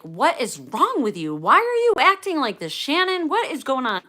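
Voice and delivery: loud, high-pitched mock scolding; retold parental outburst, rising high and loud; exasperated dad. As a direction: imitate a fed-up father building to a yell. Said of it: "And my dad is like".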